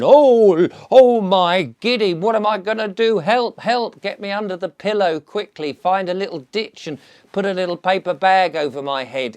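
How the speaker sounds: high, loud hammed-up terror; comic panicked wail, loud then settling; mock panic. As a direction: flap about like a frightened ninny. Acting frightened person hiding from dragon.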